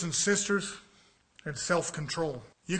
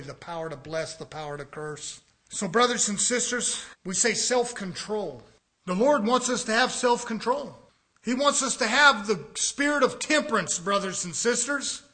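ordinary delivery

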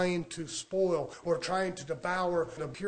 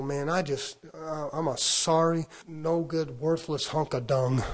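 slow, groaning mock self-pity; complaining natural man; 2 semitones lower than usual. Preacher playing a wretched man listing ailments, groaning. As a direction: moan and drag it out like a sufferer. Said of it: "I could talk to you as a man".

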